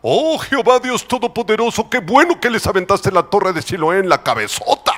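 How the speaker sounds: chanting, overblown thanks to God; sing-song sanctimonious prayer; mock pious prayer. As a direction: pray aloud with hammed-up gloating. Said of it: "los otros judíos que decían".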